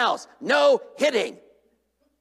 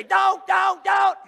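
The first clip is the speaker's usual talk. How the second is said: higher, sharp repeated yell; father yelling at his son in a sermon, higher and sharp; mock yell; 8 semitones higher, much slower than usual. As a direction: yell the word at a naughty child. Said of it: "yell at him and say".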